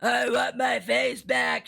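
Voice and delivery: slow shrieked plea. Acted. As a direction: shriek at him, dragging it out. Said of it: "she shrieked".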